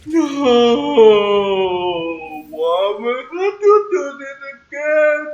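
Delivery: long drawn-out, slow crying wail; wailing despair. Real, not acted. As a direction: stretch it into a loud, rising wail.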